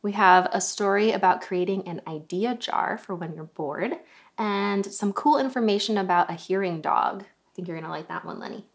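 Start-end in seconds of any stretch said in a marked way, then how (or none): none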